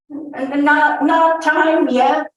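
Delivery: high, loud stammered protest; nervous stammer. A narrator's reading. William at the door answering the soldier, nervous stammer. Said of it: "sneezing and stammering".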